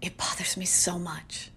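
it bothers me so much whispered; exasperated whisper. Real, not acted. Vlogger whispers how much the word bothers her. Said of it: "dear God".

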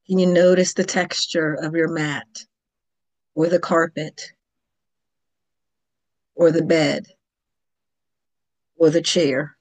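calm, unhurried Yoga Nidra guidance with long pauses; yoga teacher leading a guided relaxation, calm and measured; guided meditation. Acted. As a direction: gently guide the listener toward sleep. Leads a Yoga Nidra session.

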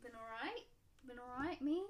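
put-on light Welsh accent, lilting rise at the end. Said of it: "You're doing that Welsh thing".

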